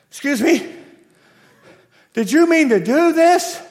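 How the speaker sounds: high, loud disbelief; incredulous high-pitched server; stunned questioning. Acted. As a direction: demand to know, astonished. Server stunned by big tip.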